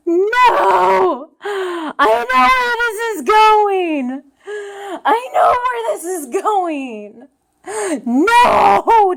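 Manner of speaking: loud, drawn-out crying plea; mock-anguished protest. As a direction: wail in overdone dread. Dreads the sad turn.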